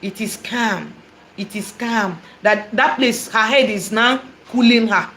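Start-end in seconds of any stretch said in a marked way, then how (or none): 0.0-2.2 soothed murmur. Retold or imitated. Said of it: "my mother will be like".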